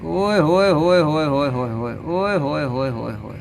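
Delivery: slow sing-song cooing; cooing call. Acted. Cooing slowly at a crow to make it stay. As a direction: coax it with a drawn-out "ओए होए होए".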